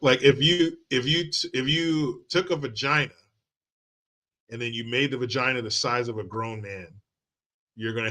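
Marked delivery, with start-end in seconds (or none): none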